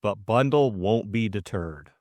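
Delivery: usual speaking voice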